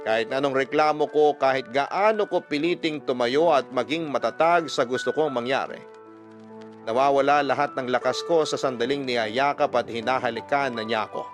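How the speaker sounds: ordinary talk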